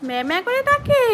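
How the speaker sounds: put-on high, bright bleating for a child; goat bleat demo. Shown as a demonstration. Mother showing her toddler how a goat goes, high bleat. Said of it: "জোরে বলো।".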